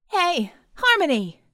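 high, loud, drawn-out hail; shouting into walkie-talkie. A narrator's reading. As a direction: call out over high winds. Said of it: "shouting through a handheld walkie-talkie".

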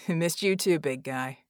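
warm chuckle through words; chuckling. Acted. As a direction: laugh it out fondly. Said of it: "Red chuckled".

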